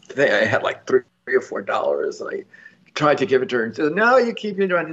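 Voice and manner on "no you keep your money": put-on higher-pitched Southern old woman, insisting; imitated Southern accent. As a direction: refuse in a light Southern voice. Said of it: "in a very Southern accent".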